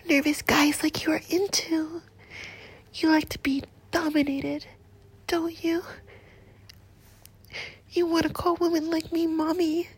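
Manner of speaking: ordinary talk, nothing marked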